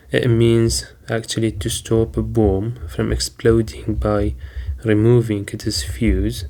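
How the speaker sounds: ordinary talk, nothing marked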